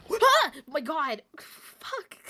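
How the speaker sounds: jump-scared, shouting high and loud; startled yelp. Real, not acted. Streamer startled by a game jump scare, yelling. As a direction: jolt at the scare and cry out.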